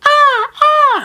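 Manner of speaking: shrill, very high squawking cries like a gull; mock gull squawk yelling for Mom; seagull screech. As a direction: screech it out like a seagull stealing food. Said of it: "You're gonna hear".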